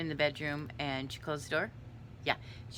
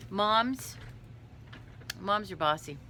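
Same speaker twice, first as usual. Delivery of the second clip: ordinary delivery, nothing marked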